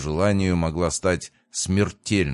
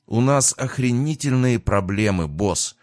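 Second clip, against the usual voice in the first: urgent outburst, pitched higher; blurted out; 6 semitones higher, 3 dB louder than usual. A narrator's reading. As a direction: blurt out the bad news to the boss. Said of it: "вместо приветствия выпалил Маус". (взволнованно, выпалить с ходу)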